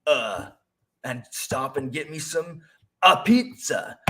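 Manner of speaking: high, loud, lazy bro voice; mock Chris Pratt playing Mario as a dude; high, loud, slow, lazy dude-bro drawl. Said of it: "I don't wanna be like".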